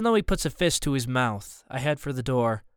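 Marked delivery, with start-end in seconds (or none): none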